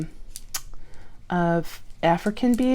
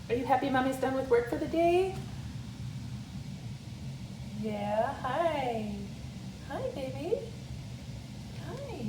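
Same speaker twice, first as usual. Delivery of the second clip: talking to a pet; 9 semitones higher, 11 dB quieter than usual. Real, not acted. Cooing to dog Goldie after outro.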